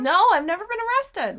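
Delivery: high, loud protest; indignant denial. Real, not acted. Denying co-host's teasing about arrest, indignant.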